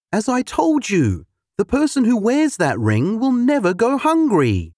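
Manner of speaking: high, reproachful woman's voice; a hint of an upset wife. A narrator's reading. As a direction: scold him over the ring. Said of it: "said a distressed woman".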